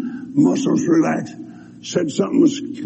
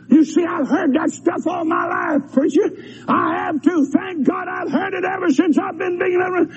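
higher, eager shouted reply; a churchgoer shouting back at the preacher; church member; 12 semitones higher than usual. Retold or imitated. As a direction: answer the preacher with zeal. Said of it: "voicing a member of the congregation".

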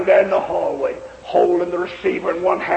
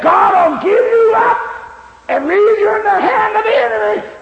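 preacher threatening a backslider with God's judgment; thundering warning; 7 dB louder than usual. Retold or imitated. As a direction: hurl the warning at him.

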